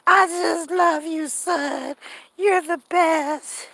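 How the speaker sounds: high, slow, hammed-up teary gush; mock weepy mother. Mother tearfully gushing over her son, mock crying. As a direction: blubber it like an over-the-top mama.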